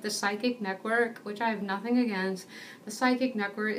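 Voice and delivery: usual speaking voice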